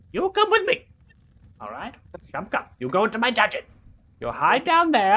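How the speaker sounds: high, loud fussing mother voicing; motherly NPC. GM as fussy mother hiding the party, high and loud. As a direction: shoo them in, high and loud.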